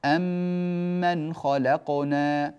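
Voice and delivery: slow, one doubled meem in أم من; merged meem. Shown as a demonstration. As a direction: press the two meems into one long m. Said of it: "بميم واحدة مشددة".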